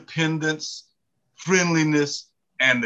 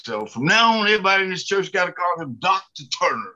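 raised-pitch mock pulpit announcement; preacher proclaiming; 5 semitones higher, faster than usual. Preacher tells congregation of the new title, proclaiming. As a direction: imitate a preacher addressing the whole church.

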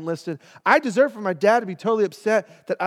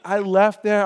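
normal talking voice

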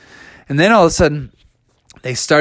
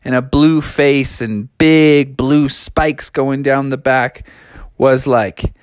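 ordinary delivery

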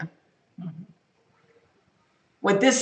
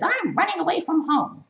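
a high-pitched mock child's voice; little kid; 9 semitones higher than usual. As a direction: pout like a kid threatening to leave. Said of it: "Like a little kid who says".

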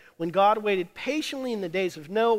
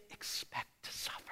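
breathy stage whisper; 17 dB quieter than usual. Real, not acted. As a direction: drop to a whisper. Sermon climax, whispered line.

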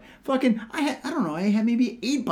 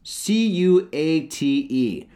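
ordinary delivery

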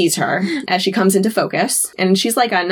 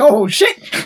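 startled mock outburst, higher pitched; shocked; 4 semitones higher than usual. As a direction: act out a director's shock. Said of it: "The director is shocked".

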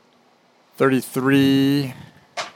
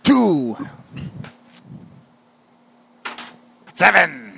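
flower voice; 5 semitones higher, much slower than usual. Pretend. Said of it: "talk in flower voice".

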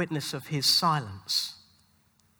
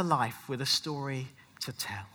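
whisper; 6 semitones lower, 6 dB quieter than usual. Acted. Preacher on the resurrection, whispering. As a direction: drop suddenly to a whisper. Hushed line after loud one.

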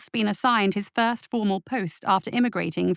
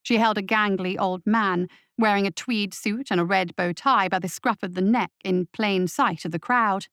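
ordinary delivery, nothing marked

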